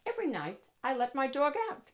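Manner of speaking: ordinary delivery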